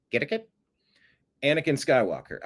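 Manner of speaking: usual speaking voice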